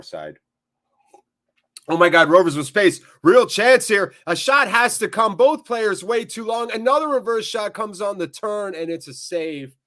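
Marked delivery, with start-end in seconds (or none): 1.9-9.8 loud, high excited match call; urgent football commentary, high and fast-breaking; live play-by-play. Real, not acted. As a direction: call the chance as it unfolds, easing off at the save.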